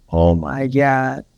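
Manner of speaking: slow, drawn-out teary awe; tearful awe. Retold or imitated. Mother tearing up, slow and awed. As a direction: let it fall out slowly, moved. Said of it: "She's like".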